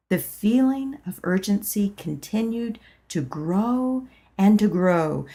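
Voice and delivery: plain reading voice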